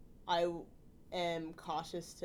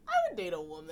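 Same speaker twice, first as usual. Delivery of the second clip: mock college girl; 5 dB louder, faster than usual. Mocking a college girl type.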